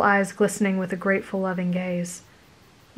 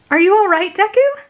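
worried; 12 semitones higher, 7 dB louder than usual. A narrator's reading. Uraraka rushing over worried.

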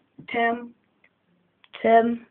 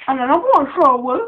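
playful invitation; 4 semitones higher, 6 dB louder, much faster than usual. Pretend. Feeding the pet, playful.

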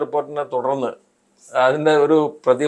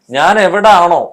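louder, higher mock-indignant quote; imitated indignant rebuke; 4 semitones higher, 8 dB louder, slower than usual. Minister rebuking reporters over their questions, imitated indignant retort. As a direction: act out an overbearing retort.

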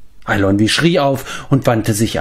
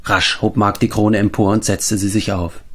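ordinary delivery, nothing marked

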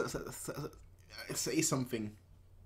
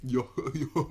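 Goofy; 7 dB louder than usual. Shown as a demonstration. Reactor showing off his best try, loud goofy impression. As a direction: do a dopey cartoon guffaw. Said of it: "That's my best goofy impression".

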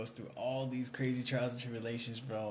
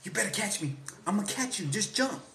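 much higher and louder, urging call; hammed-up comic dare; mock movie quote; 9 semitones higher, 5 dB louder than usual. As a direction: call down from the yacht. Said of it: "He's like".

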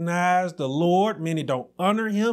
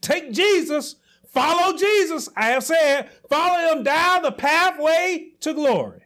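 a raised preacher's plea in God's voice; God's urgent call; 6 semitones higher than usual. Acted.

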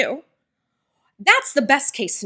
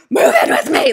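excited; 4 semitones higher, 7 dB louder than usual. Retold or imitated. He was so excited.

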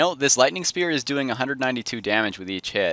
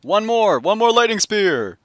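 high loud victory yell; battle shout; 10 semitones higher, 6 dB louder, slower than usual. Real, not acted. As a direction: yell it as you attack. Spell casting in fight.

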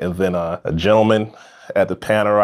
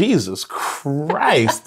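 stunned disbelief; 8 semitones higher, much slower than usual. Retold or imitated. Retold reaction of disbelief.

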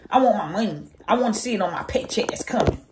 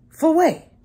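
"For what?" thrown out higher and louder, offended; indignant; 6 semitones higher, 4 dB louder than usual. Retold or imitated. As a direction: snap back "For what?" loudly, suddenly wide awake. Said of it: "Your voice just changed".

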